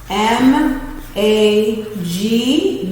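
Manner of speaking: very slow letters L E T; teacher spelling letter; spelling out slowly. Shown as a demonstration. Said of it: "spelling magnet by parts".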